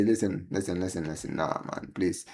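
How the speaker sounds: ordinary delivery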